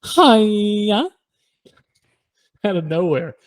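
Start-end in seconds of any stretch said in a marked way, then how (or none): 0.0-1.1 high, drawn-out hi-ya rising at the end; imitated random noise. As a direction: blurt a long, high mimicked noise. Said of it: "like, a random".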